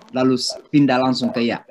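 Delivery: ordinary talk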